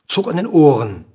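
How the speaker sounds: ordinary delivery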